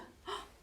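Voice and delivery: soft breathy mock gasp of alarm; playful startled gasp for the kids. Startled by loud puppy.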